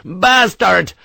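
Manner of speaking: loud, high curse; furious yell. Acted. Harper curses the French officer, furious. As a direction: spit the word in fury. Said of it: "The Irishman was in a fury".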